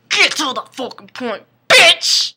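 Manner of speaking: building to a loud yell; mock impatient anger. Vampire tells the rambling girl to hurry up, mock angry yell. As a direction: explode on the last word.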